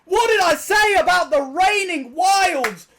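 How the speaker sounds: huge high, loud shouts, drawn out; ecstatic shouting. Real, not acted. Streamer to viewers as the bonus lands, ecstatic shouting. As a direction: explode with joy at a big win. Big slot win celebration.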